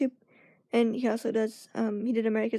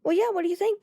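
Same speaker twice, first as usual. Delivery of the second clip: friend pressing eagerly; 8 semitones higher than usual. Retold or imitated. Friend showing off nails, higher and eager. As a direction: push for an opinion, eagerly. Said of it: "she was like".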